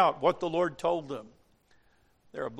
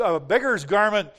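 ordinary talk, nothing marked

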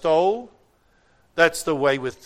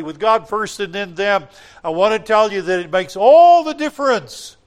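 louder, building exhortation; fervent preaching; 3 semitones higher, 8 dB louder than usual. Acted. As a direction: drive the appeal home to the congregation. Preaching at full force.

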